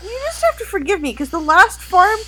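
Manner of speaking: high, teary voice building to a crying shout; a worried girl breaking into sobs; tearful worry. Acted. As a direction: plead through rising tears. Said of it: "she'll be like".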